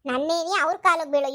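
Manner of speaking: normal talking voice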